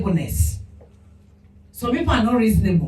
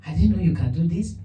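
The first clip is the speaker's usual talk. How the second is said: put-on soft, kindly praise for a child, softer and lower; gentle encouragement; 5 semitones lower, 5 dB quieter than usual. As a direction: gently admire her work, then hurry on. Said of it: "I smiled at her and I said".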